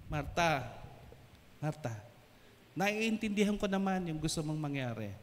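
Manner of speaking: low, soft and soothing; gentle. Acted. Jesus soothing anxious Martha, gently. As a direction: reassure her quietly. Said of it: "he's so gentle".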